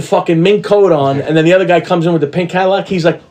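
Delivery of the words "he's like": usual speaking voice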